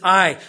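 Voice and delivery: "I" punched hard and loud; stress on I. Acted. Pastor insists Jesus himself gives life, stressing "I". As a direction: lean all your weight on "I". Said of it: "me, myself, personally".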